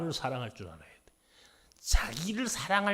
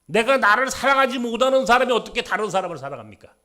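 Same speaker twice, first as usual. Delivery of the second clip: loud, high, impassioned sermon; raised fervent preacher's voice; preaching at full force; 8 semitones higher, 12 dB louder than usual. Acted. Pastor preaching to congregation.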